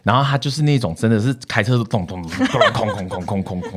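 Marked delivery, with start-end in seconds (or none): 1.8-3.8 模仿破车发动的咚咚空空声; car engine noise. Retold or imitated. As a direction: mimic a sputtering old car, laughing. Imitating a slow clunky car. (笑着模仿老爷车的声音)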